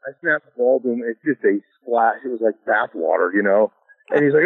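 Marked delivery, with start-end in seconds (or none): none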